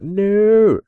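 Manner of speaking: a loud, high "No!"; dismayed shout. Retold or imitated. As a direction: howl in dread at the screen. Dreading opponent's star striker.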